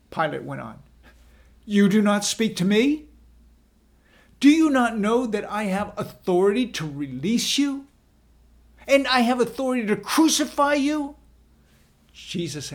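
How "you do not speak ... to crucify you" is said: loud, high, speeding up at the end; building anger. A narrator's reading. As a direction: demand an answer, rising in anger. Pilate presses the silent Jesus.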